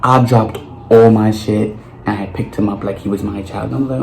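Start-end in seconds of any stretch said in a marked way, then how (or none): none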